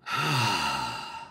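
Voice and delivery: long, open exhaled sigh, falling away; slow sigh of letting tension go; releasing sigh. Shown as a demonstration. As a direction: let a deep belly breath out on an "ahh". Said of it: "release that with a sigh".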